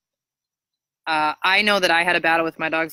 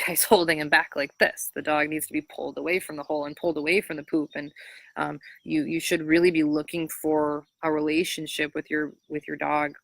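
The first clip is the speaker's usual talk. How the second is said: ordinary talk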